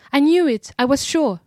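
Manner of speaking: high, loud triumphant cry; exulting cry. A narrator's reading. The woman cries out on hearing her name, exulting. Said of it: "an exulting and terrible cry".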